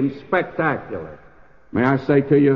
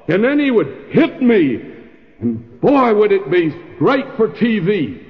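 indignant general ranting; 6 semitones higher than usual. Acted. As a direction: complain like a snubbed five-star general. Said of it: "Naaman says".

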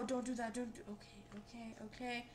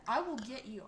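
ordinary talk, nothing marked

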